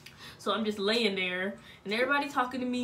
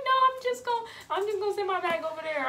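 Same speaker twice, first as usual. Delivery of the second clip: voicing him in a high squeaky tone; quoting him high and quick; mock high voice; 9 semitones higher, faster than usual. Retelling his words at the hospital in a high mock voice.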